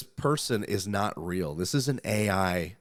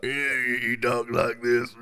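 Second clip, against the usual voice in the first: rough diesel-engine growl, louder and a bit higher; Don Fry impression; 4 semitones higher, 4 dB louder than usual. Host imitating the rugged fighter for co-hosts, rough diesel growl. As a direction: rumble it out like a road-flare-smoking cowboy fighter. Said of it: "Don Fry smokes road flares".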